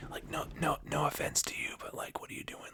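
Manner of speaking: teasing hushed whisper; whispered aside. Real, not acted. Host whispering a half-apology to gender studies majors, teasing whisper. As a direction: whisper the dig behind your hand.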